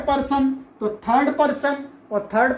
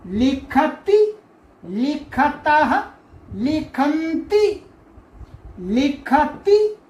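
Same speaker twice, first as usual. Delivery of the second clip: slow, stretched लिखति, लिखतः, लिखन्ति; slow recitation; slower than usual. Shown as a demonstration. Teacher drilling verb forms three times for the class. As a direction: chant लिखति, लिखतः, लिखन्ति slowly with rising ends. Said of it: "तीन तीन बार बोलना है आपको".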